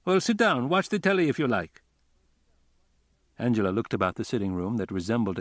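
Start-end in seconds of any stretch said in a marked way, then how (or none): 0.1-1.8 voiced hostess. A narrator's reading. Hostess invites Angela to sit.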